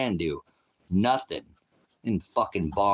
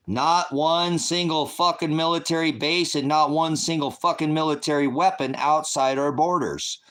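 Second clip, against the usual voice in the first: shouting it out, higher and louder; furious shout; 7 semitones higher, 4 dB louder than usual. Real, not acted. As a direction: hammer every word of the demand. Ranting against foreign military bases.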